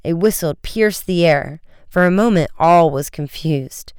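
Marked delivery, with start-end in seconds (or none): none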